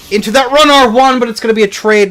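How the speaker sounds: very high, loud rush of commentary; excited play-by-play. Real, not acted. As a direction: call the decisive hit at full voice. Live call of decisive exchange.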